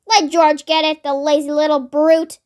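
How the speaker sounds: ordinary delivery, nothing marked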